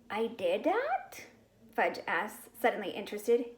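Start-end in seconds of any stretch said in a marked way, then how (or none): 0.1-1.3 put-on slow, wondering little boy voice; a small child amazed at himself; little kid wonder. A narrator's reading. Fudge suddenly interested.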